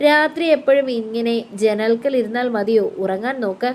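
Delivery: ordinary delivery, nothing marked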